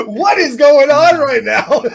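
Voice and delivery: high, loud, drawn-out cry through laughter; helpless loud laughing disbelief; real laughing outburst. Real, not acted. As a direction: crack up while calling it out. Host cracks up at the banter.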